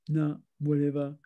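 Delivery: mild, lightly voiced teen grunt; a low, bored brush-off like a sulky teen; grunting teenager. Teenager brushing off a parent's question, lightly mock grunted. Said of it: "when the teenagers start grunting".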